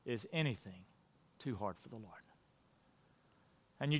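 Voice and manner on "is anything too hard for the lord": much softer and lower, a tender question; gentle voice of God. Retold or imitated. God gently answering doubting Sarah, soft and tender. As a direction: ask it gently, almost hushed, like a loving father. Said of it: "And God says to her".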